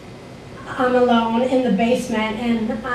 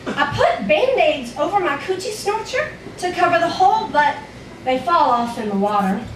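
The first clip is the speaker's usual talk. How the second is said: put-on frightened little child narrator; 2 semitones higher than usual. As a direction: tell it as a worried five-year-old.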